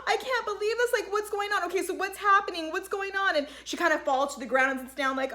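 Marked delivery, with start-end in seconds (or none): 0.1-3.4 high-pitched, flustered questioning; mock overwhelmed disbelief. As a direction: imitate her reeling in disbelief, voice raised high. Acting out her overwhelmed reaction.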